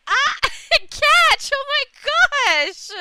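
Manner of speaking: high, loud delighted shriek; overwhelmed squeal. Real, not acted. Streamer thanking chat for a gift, shrieking with delight. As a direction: squeal with real surprise at the gift. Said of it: "I'm a little bit overwhelmed".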